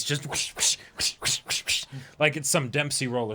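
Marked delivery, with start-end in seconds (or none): 0.0-1.8 breathy whoosh sound effects, over and over; whooshing slap noises. Retold or imitated. Mimicking slaps to the face, in breathy whooshes. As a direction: mimic quick slaps through the teeth. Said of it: "Just slap him in the face".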